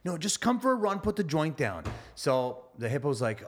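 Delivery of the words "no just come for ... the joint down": high coaxing rabbit voice; higher rabbit nagging; rabbit insisting. Acted. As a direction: push him gently, pitched up. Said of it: "And he's like".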